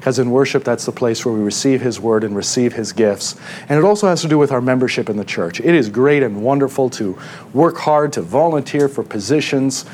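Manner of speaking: ordinary talk, nothing marked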